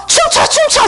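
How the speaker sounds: very high-pitched urging cry; retold shouting. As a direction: yell it like a dance-party order, re-enacted. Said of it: "막 소리 지르면서".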